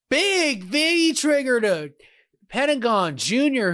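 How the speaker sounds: high, slow, stretched hype call; excited call. Real, not acted. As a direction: sell the big hit. Big move in live commentary.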